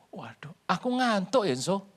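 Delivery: drowsy, whispered sigh; sleepy whisper. Retold or imitated. Sisera telling Yael he is sleepy, whispered. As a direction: whisper as if nodding off.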